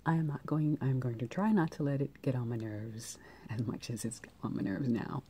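normal talking voice

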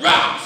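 high, loud mock roar; explosive snake "rah"; imitated snake strike. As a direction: lunge out suddenly as the movie snake. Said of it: "another snake pops up".

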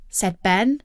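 usual speaking voice

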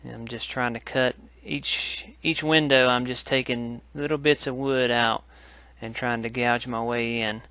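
normal talking voice

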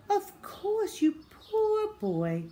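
high, slow motherly coo; cooing sympathy. Acted. Mum pitying her supposedly sick son, cooing. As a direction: coo it tenderly to a poorly child.

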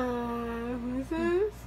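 ordinary talk, nothing marked

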